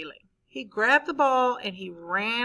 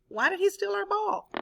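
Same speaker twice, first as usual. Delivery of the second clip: shocked; 10 semitones higher than usual. Retold or imitated. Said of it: "shocked, confused".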